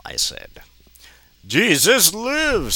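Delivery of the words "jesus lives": slow, high, drawn-out churchy proclamation; preachy kid. Acted. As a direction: sing it out like a pious kid.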